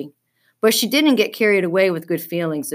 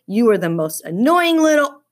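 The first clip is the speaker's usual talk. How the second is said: angry outburst; 5 semitones higher, 5 dB louder than usual. A narrator's reading. Lindsay insults Dave, cut off.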